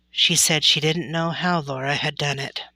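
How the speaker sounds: ordinary delivery, nothing marked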